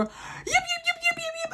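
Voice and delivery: very high yapping dog barks; imitated yapping. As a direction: yap like a tiny dog at the door.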